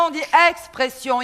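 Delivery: "expression" with a clear ks for the X; high, insistent "expression", sounding eks-pression; correcting pronunciation. Shown as a demonstration. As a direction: loudly stress the X in "expression". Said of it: "Il y a un X à expression".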